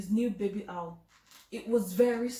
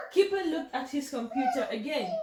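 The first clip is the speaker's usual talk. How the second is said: ordinary delivery, nothing marked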